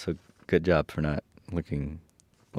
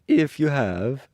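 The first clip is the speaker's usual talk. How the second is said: high, slow and louder tin man; Tin Woodman; 9 semitones higher, 6 dB louder, slower than usual. A narrator's reading. Tin Woodman answering the lion, high character voice. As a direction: raise and stretch the character voice. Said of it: "continued the Tin Woodman".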